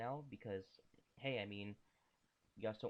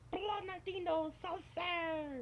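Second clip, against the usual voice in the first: slow, much higher, goofy sing-song cry; silly high drawn-out voice; much slower than usual. Real, not acted. Gamer blurting nonsense on autopilot, high and slow. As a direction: let a nonsense phrase slip out high and slow. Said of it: "I wasn't even meaning to do that".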